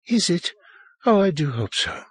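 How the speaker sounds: high, plaintive plea; small, high voice hoping; tearful hope. A narrator's reading. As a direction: plead for reassurance after sobbing. Sobbing woman seeks reassurance.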